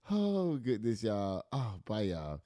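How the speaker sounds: ordinary delivery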